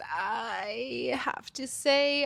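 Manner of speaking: slow, breathy confiding whisper. Real, not acted. Host whispering a guilty confession to listeners, slow whisper.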